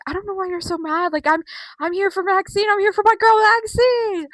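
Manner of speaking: high retort building to loud cheer. Retold or imitated. Vlogger mimicking A J backing Maxine, building excited retort. Acting out A J's taunting reply.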